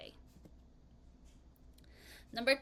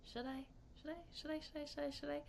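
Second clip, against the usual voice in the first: teasing whisper; 4 semitones higher, 12 dB quieter, much faster than usual. Real, not acted. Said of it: "teasing repeated whisper".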